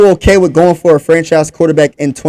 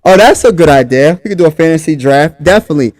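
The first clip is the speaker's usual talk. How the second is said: ordinary delivery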